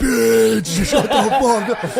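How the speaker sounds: crowd yelling. Retold or imitated. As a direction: yell it like a rowdy crowd at a heckler. Crowd of guys yelling at heckler.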